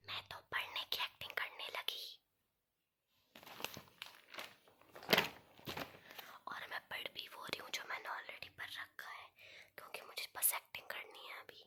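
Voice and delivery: hushed whisper, hiding from mom. Real, not acted. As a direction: whisper so nobody downstairs hears. Whispering so mom won't hear.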